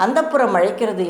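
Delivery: usual speaking voice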